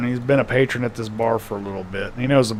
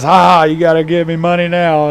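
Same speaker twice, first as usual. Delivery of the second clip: gloating bartender; 3 semitones higher, 5 dB louder than usual. Acted. Bartender gloats over won bet.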